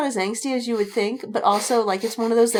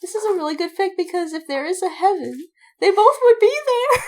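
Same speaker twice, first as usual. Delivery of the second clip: rising, higher and louder gush; 10 semitones higher, 6 dB louder than usual. Retold or imitated. Fan recalling her reaction to a fanfic, gushing high. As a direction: swoon over how good the fic is. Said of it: "and I was like".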